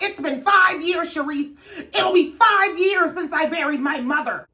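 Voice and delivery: tearful outburst. Retold or imitated. Karen emotional about mother.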